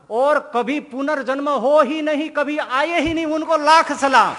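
rising high and loud in preaching fervour; fervent loud praise. Real, not acted. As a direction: proclaim it with full force to the crowd. Preacher praising the liberated souls.

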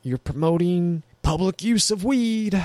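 high, prissy put-on official voice; mocking city official. Mocking the city that cancelled the cannabis concert, high prissy mock voice. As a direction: parody a fussy official banning fun. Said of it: "the city was like".

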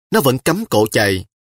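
ordinary delivery, nothing marked

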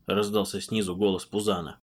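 plain reading voice

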